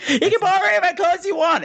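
high, rapid mock call with laughter; strutting away. Mimicking Linda Blair's offer of clothes while strutting, high rushed mockery. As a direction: toss it off fast and high, strutting off. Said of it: "saying this as she was strutting away".

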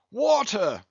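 high exclamation from the captain; crying out. A narrator's reading. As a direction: burst in on the doctor's sentence. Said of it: "cried the captain".

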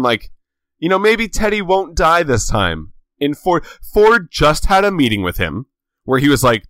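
ordinary talk

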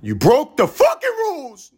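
high loud squeaky yell; mock angry texter. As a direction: shriek the accusation in a silly voice. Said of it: "somebody texted me".

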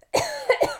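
sharp high cough; a second cough bursts out; coughing again. Real, not acted. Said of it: "That was so sudden".

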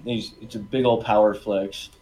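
ordinary talk, nothing marked

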